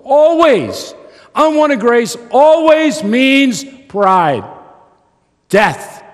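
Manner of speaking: high, loud, slow proclamation; preaching shout. Real, not acted. Preacher to the congregation, high loud preaching shout. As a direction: hammer it home from the pulpit.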